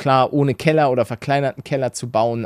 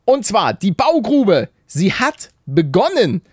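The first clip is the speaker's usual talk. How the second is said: announcer; 5 semitones higher than usual. Acted. Announces house update segment.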